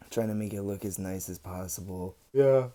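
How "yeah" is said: talking through a real yawn, higher and louder. Real, not acted. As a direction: let a yawn stretch the words. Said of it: "Sorry, I just yawned".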